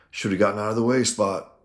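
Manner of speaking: mock the man you just shot; cold taunt. Gang leader sneering at the henchman he shot, loud.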